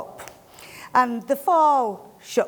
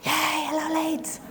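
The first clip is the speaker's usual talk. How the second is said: much higher, bright cheer to the crowd; cheery greeting; 8 semitones higher than usual. Real, not acted. Greeting visitors from Leeds.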